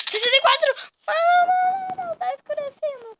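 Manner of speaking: high, loud excited cry; excited yell. Real, not acted. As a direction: panic as it gets dark. Night falls in game.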